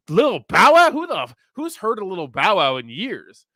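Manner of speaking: high, loud mock disbelief, then drawn out; retold incredulous reaction. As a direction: blurt the name, then stretch the question incredulously. Retelling his astonished reply at the gym.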